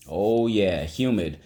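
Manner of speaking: ordinary talk, nothing marked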